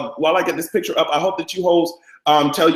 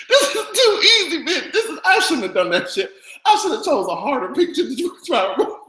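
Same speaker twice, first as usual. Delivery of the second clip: laughing; 11 semitones higher than usual. Real, not acted. Cracking up at the photo.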